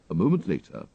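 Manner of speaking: usual speaking voice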